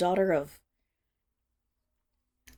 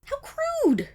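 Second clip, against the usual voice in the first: offended exclamation. Acted. Offended by the buyer.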